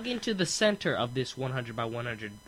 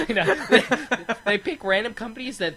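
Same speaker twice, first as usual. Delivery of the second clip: laughing; 7 semitones higher, 4 dB louder than usual. Real, not acted. Laughing while joking.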